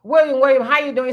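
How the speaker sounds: loud, bright call of a name twice. Real, not acted. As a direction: call him out across the room. Greeting a chat member, calling out.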